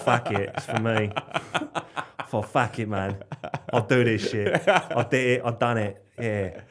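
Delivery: swagger it like a cocky English lad; British accent. Acted. Telling the host his plan, in a cocky laddish British accent. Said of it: "in that accent".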